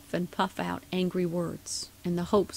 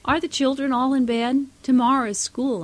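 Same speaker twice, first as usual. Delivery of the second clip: higher, louder call through the door; 6 semitones higher, 6 dB louder than usual. A narrator's reading. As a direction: call out to the sleeping town. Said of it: "crying through the lock".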